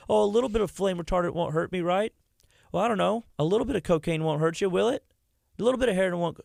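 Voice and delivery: mocking someone who brushes off the risk; mocking careless people. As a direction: toss it off like someone who doesn't care. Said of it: "That's what people think".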